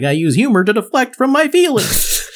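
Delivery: high, slow, sing-song Chandler voice; Chandler Bing impression. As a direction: mimic Chandler's whiny drawl. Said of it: "him being like".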